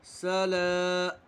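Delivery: सला with ला held long; stretched ला. Shown as a demonstration. Teacher stretching ला in सला for pupils. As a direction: draw out the second syllable slowly. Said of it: "एक अलिफ के बराबर खींचकर पढ़ेंगे".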